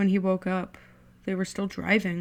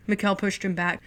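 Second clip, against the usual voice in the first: usual speaking voice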